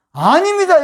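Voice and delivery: high, loud firm no; a pulpit-strong denial; emphatic denial. Real, not acted. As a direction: cut the rumour down hard. Preacher denying a slur on Jeolla people.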